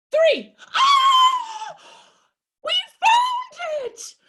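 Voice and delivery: very high, loud joyful shout; excited shout. Pretend. Found the hidden duck with the kids, high excited shout. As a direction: cheer as if you found treasure.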